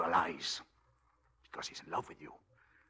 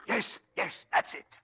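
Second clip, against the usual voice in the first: eager urging. Acted. Eager high pitch, +18.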